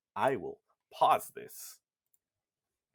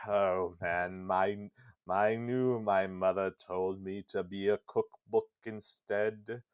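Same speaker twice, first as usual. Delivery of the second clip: Weiss; 2 semitones lower than usual. Acted. Hopping book bemoaning his fate, put-on self-pitying voice. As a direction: put on a pompous book lamenting his lot. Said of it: "Grimoire Weiss is just like".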